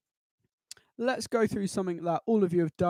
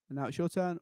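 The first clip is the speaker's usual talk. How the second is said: ordinary talk, nothing marked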